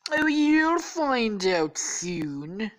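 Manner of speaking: high, very slow, drawn-out taunt; hammed-up presidential impression, loud and stretched; Donald Trump. Trump taunts the bagged captive, hammy impression. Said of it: "then Trump is like".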